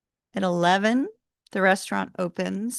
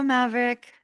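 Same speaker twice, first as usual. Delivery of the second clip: ordinary talk, nothing marked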